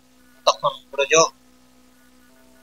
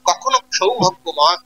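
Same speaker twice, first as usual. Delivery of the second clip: normal talking voice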